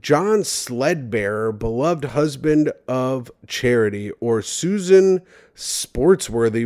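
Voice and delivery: slow, savouring each name; slow dramatic read. Shown as a demonstration. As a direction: read the names slowly, like a grand announcement. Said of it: "Wow, what, what names?".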